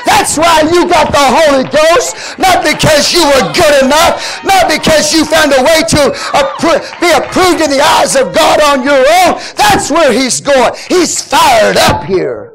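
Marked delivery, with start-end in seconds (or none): 0.1-9.4 preaching at full force. Acted. Preacher pressing the point to the congregation, full force. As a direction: drive it home like a pulpit climax.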